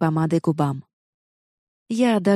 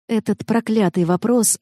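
plain reading voice

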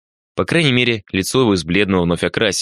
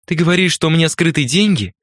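higher-pitched, accusing question, lightly acted; hoarse-voiced woman; 9 semitones higher than usual. A narrator's reading. Accused woman confronts the wounded man at his bedside, higher-pitched hoarse challenge. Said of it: "спросила она хриплым голосом".

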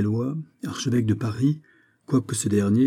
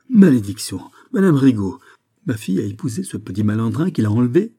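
much louder, furious curse; cursing outburst; 3 semitones higher, 6 dB louder than usual. Acted. The count learns his daughter married her abductor, raging loudly. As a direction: explode at the news like a wounded father.

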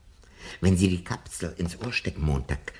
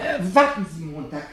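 calling out; 10 semitones higher, 7 dB louder than usual. Acted. Professor calls Montag back.

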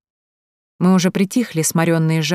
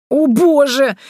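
high, loud wail; loud lament, pitched up; dismayed outcry; 9 semitones higher, 7 dB louder, much slower than usual. A narrator's reading. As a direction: cry it out in exaggerated despair. Erika dismayed at her body.

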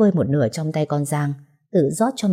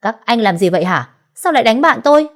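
much higher, louder desperate shout; helpless shouting; 6 semitones higher, 6 dB louder than usual. Acted. Thugs slap her friend, she shouts in desperation. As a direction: yell at them, powerless. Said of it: "chỉ biết quát".

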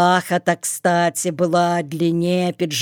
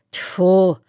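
usual speaking voice

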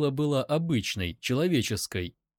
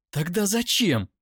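high, exclaimed question; distraught demand; 8 semitones higher, 4 dB louder than usual. A narrator's reading. Captive demands to know his reason, distraught. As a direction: demand an answer desperately.

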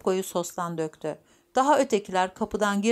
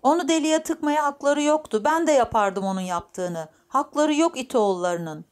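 lightly voiced indignant old grandfather, a little louder; hafifçe canlandırılan hiddetli dede; 6 semitones higher, 4 dB louder than usual. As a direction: protest as a riled-up grandfather, lightly acted. (hafif öfkeli dede sesi)

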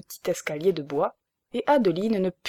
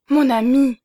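loud, higher cry of joy; overjoyed; 6 semitones higher, 7 dB louder than usual. A narrator's reading. Wife overjoyed at finding her husband, loud and high. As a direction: burst out with happiness. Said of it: "oubliant tout dans l'excès de sa joie".

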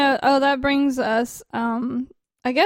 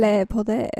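mock French; 2 semitones lower than usual. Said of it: "That's how they say it in France".

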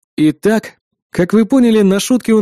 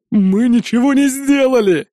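high, slow wailing denial; desperate protest; 6 semitones higher than usual. A narrator's reading. Twin answers interrogator under threat, desperate protest. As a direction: wail your innocence in a pitched-up voice.

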